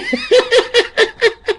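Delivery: high cackle in rapid bursts; high, pulsing laugh. Real, not acted. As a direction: cackle at your own joke. Cracks up at own joke.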